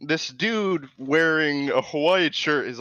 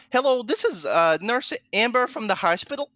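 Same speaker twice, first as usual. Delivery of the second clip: nurse voice; 8 semitones higher than usual. Retold or imitated. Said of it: "she didn't sound like that".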